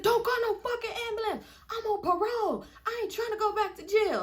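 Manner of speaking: high-pitched, strained mock protest; agitated shot man. Shot boy on parole refusing help, high agitated mimicry.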